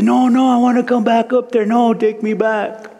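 high, pleading cry, slowing at the end. Retold or imitated. Begging to return to the clouds, high and pleading. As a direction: beg to go back up.

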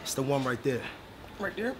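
very soft, secretive muttering; hushed scheming. Acted. Man sending friend to distract the clerks, hushed and urgent. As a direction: keep it down while plotting. Whispered scheming before camera theft.

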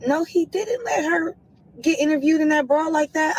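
half-acted shock at the news; shocked disbelief. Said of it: "I'm like".

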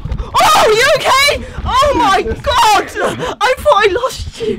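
high, loud hammed-up worry over a toy; hammed-up distressed cry; mock panic. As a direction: fuss like you lost your child.

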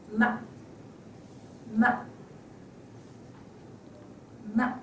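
"ma" very brief, neutral tone; short and light. Shown as a demonstration. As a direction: tap "ma" out lightly and quickly. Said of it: "pronounce it short and lightly".

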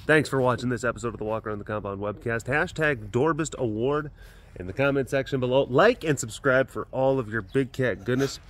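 upbeat sign-off read to viewers; vlog outro. Acted. Signing off the webcast to viewers, upbeat. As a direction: wrap up the episode cheerfully.

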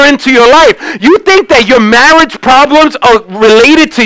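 high, driving sermon delivery; full-force preaching. Real, not acted.

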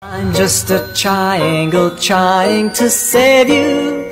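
ordinary talk, nothing marked

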